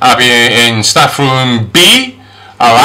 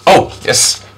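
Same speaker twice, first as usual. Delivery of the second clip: ordinary talk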